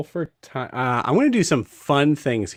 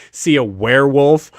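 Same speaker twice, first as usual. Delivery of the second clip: ordinary talk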